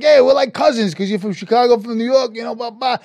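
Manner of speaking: high, loud chummy pitch; jovial banter. Retold or imitated. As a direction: win over a new pal excitedly. Retold jovial banter with her.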